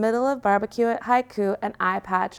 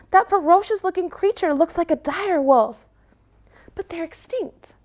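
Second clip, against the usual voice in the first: panicked puppy; 8 semitones higher than usual. Acted. Little puppy panicking over her brother, very high and fast.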